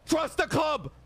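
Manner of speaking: high, hard scolding; harsh and severe. Acted. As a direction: bark the orders at him. Scolding climax of club argument.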